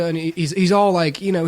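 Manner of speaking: ordinary talk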